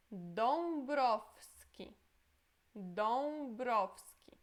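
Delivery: Dąbrowski very slowly, ą as "on", w as f; Polish nasal ą. Shown as a demonstration. Teacher showing the ą sound to students, very slow. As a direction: stretch Dąbrowski to show the nasal vowel. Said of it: "Essa letra é on".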